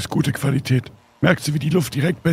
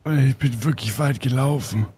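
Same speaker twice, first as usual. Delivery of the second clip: heavy breathing; 3 semitones lower than usual. Real, not acted. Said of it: "Warum atmest du so schwer, als wärst du tot?".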